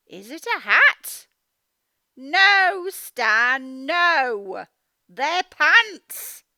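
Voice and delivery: loud playful call to Stan; mum calling out. Acted. Mum calling loudly to Stan about his pants. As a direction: call out to Stan in mock alarm.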